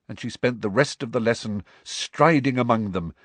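ordinary delivery, nothing marked

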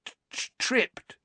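put-on high, stammering, frightened boy; trembling, stuck on the first sound; shaky. A narrator's reading. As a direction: stutter out the word shakily. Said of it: "said Dudley shakily".

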